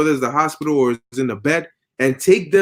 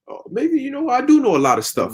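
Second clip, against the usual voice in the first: mock proud self-talk; 8 semitones higher than usual. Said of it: "you're like".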